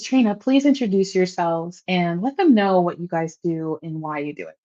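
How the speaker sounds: ordinary talk, nothing marked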